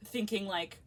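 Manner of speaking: usual speaking voice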